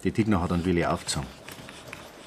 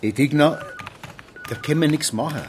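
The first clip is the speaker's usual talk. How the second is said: raised warning; 7 semitones higher than usual. Acted. Policeman raises his voice to calm the angry aunt. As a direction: cut her off firmly. Policeman calls her to order.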